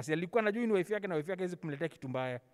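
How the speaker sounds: usual speaking voice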